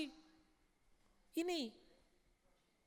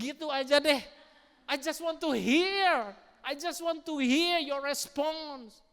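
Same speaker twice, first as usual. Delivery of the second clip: pleading prayer; 6 semitones higher, 7 dB louder than usual. Retold or imitated. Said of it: "Tuhan, gini deh".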